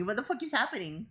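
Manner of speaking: ordinary talk, nothing marked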